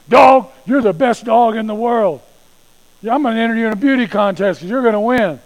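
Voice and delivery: loud, high telling-off of a dog; shouted, punchy mock reprimand; mock scolding. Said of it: "they listen to the way we say things".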